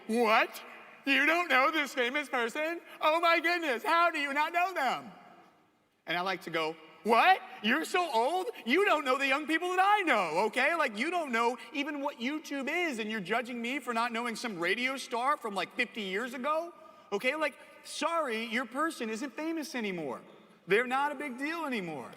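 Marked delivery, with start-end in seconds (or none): 0.1-5.1 high, loud mock outrage; hammed-up shrieky disbelief, pitched way up; scandalized parent. Parents shocked you don't know a celebrity, scandalized. 7.0-22.1 high, loud mock-indignant rant; indignant comeback. Kid answering parents about an old famous person, indignant. Child firing back at parents.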